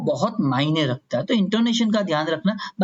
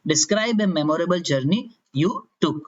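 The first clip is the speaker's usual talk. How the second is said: cue card topic; much slower than usual. Shown as a demonstration. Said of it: "जैसे आपको एक topic आ गया".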